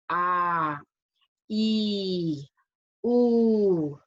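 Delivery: आ, ई, उ each held long and steady; long drawn-out vowels. Shown as a demonstration. Teacher showing pupils long vowels, drawn out. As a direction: stretch आ, ई and उ to double length, one by one. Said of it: "उच्चारण करने में आपको दुगुना time लगता है".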